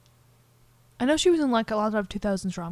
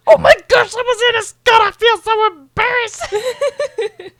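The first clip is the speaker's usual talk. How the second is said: much higher and louder, laughing through it; mortified laughing; 13 semitones higher, 13 dB louder, slower than usual. Retold or imitated. William mortified at the dinner, squealing with laughter.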